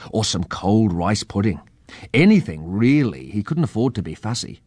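ordinary delivery, nothing marked

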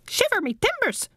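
high, soft squawk; parrot squawk. A narrator's reading. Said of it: "squawked Rumbucket".

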